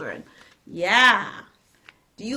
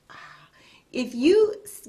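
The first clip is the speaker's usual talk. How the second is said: whispering; 2 semitones higher, 6 dB louder, slower than usual. Real, not acted. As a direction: drop to a whisper for a moment. Aside to kids.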